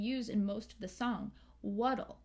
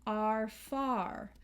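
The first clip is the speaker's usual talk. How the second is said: American "are far" with both R's sounded; American accent. Shown as a demonstration. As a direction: bite down on each R in "are far", American style. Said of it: "in American accent, we will pronounce those R's".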